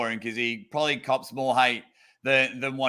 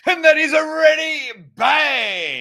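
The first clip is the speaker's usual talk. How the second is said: loud, high, slow arm wrestling call; ready bang shout; 14 semitones higher, 7 dB louder, slower than usual. Shown as a demonstration. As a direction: belt it out like a ref starting a match. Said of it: "give me your best impersonation".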